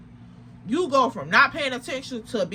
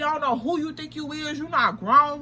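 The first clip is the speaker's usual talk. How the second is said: usual speaking voice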